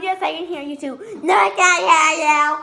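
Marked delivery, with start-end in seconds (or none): 1.2-2.6 loud, exaggerated mock denial; mocking imitation. Accusing the other of switching answers, loud mocking imitation. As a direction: mimic the denial at a much louder volume. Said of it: "you can't go from".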